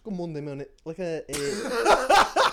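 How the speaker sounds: mock-English accent; put-on English voice. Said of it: "it kinda sounded more English".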